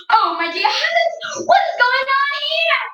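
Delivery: high, panicky wife's voice; startled mother. Acted. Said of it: "Missus Watson first said".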